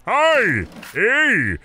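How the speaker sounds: chirpy animatronic greeter voice; creepy talking corpse doll; animatronic corpse greeting. Pretend. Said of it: "corpse points at you and says".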